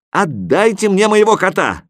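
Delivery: put-on high, insistent boyish shout; high-pitched protest; shouted demand. A narrator's reading. Boy demands his cat back.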